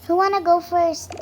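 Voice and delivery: loud call to the group. Acted. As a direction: call it out to everyone. Calling out to the dolls.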